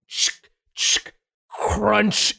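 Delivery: slow, drawn-out imitated crunch; crunch sound effect. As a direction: mouth the bone-crunch sound. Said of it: "it goes".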